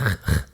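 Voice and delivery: R as a rough, throaty rasp; French R sound. Shown as a demonstration. Podcaster showing which sound hurts the throat, throaty French R. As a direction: scrape the R in the back of the throat. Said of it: "à cause des r".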